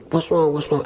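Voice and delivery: mildly panicked re-enactment; alarmed questioning. Retold or imitated. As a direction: act out a worried parent's urgent question. Acting alarmed parents rushing in.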